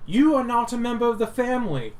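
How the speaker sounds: high, loud, fast stern paladin. Acted. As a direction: declare it like a stern holy knight. Paladin voiced in scene.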